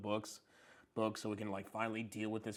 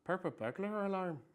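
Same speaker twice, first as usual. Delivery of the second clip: Irish accent; 8 semitones higher than usual. Shown as a demonstration. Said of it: "Try saying "purple burglar alarm" in that accent".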